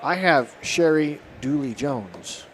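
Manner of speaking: normal talking voice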